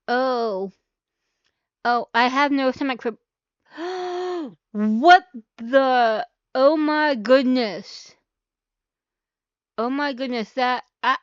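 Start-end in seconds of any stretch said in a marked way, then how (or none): 3.7-4.7 sudden shocked intake of breath; sharp gasp. Real, not acted. Reacting to shocking video. 4.7-8.1 slow, stunned disbelief; shocked. Real, not acted. Reacting to shocking video.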